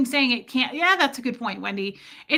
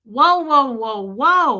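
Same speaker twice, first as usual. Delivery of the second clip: louder, stunned whoa, fading down; retold amazed whoa; 2 semitones higher, 8 dB louder, slower than usual. Telling viewers how Tommy's story floored her, stunned whoa. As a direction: replay being floored by the story. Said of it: "And I was like".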